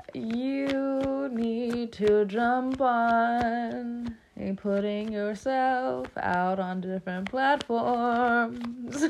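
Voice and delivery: very slow, stretched-out words, pitched a little up; playful drawn-out urging. Pretend. Urging tired viewers to jump on it, playfully drawn out. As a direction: drag every word out playfully.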